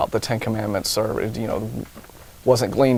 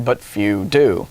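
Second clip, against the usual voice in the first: slow deadpan; 6 semitones lower, much slower than usual. Acted.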